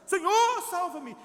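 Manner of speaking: "Senhor" gritado alto, "salva-me" mais contido; grito de socorro. Retold or imitated. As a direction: exemplo de grito aflito na hora ruim. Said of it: "a gente grita mesmo e fala". (quando tá ruim a gente grita, grito de socorro)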